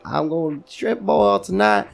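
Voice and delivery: lightly put-on Louisiana Southern accent. Imitating a lady living in Louisiana, Louisiana accent. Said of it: "fucking Louisiana".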